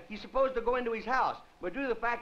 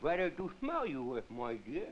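low wolf voice; 7 semitones lower, 6 dB quieter than usual. Acted. Wolf answers the girl.

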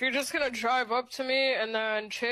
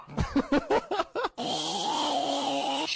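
high, incredulous burst of laughter; laughing; 5 semitones higher than usual. Real, not acted. Player baffled by another player's antics, high laughter. Laughing at the absurd game behaviour.

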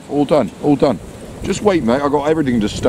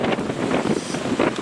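soft breathless laugh; 6 dB quieter than usual. Real, not acted. Laughs at the gust.

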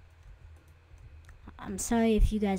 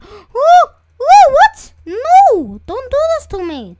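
high, loud frightened shrieks; panicked scream; 14 semitones higher, 14 dB louder, slower than usual. Real, not acted. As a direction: shriek in panic as the game attacks. Sudden enemy attack in game.

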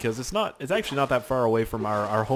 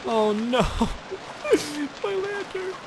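very high, squeaky wail; mock despair; slower than usual. Player mourning his lost lantern, high mock-despairing wail. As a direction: whimper upward into a falsetto lament.

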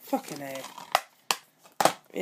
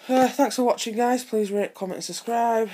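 higher, louder, bright outro pitch; upbeat sign-off; 6 semitones higher, 8 dB louder than usual. Real, not acted. Vlogger thanking his viewers, loud and excited. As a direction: pump up the goodbye like a YouTuber.

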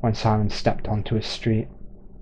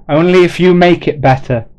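loud, higher parting call; calling back; 7 semitones higher, 9 dB louder than usual. Acted. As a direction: shout the last advice from afar. Man's final call to Simon.

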